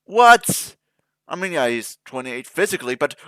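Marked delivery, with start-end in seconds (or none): 0.0-0.8 sudden, higher and louder disbelief; stunned what. Real, not acted. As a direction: blurt it out in shock. Shocked at twelve thousand years.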